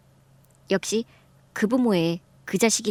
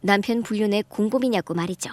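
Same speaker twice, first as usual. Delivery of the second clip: normal talking voice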